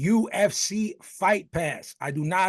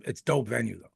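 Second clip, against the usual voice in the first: usual speaking voice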